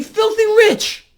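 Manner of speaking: high and loud in outrage; indignant shout. Real, not acted. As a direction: spit it out in disgust. Angry rant about bankers.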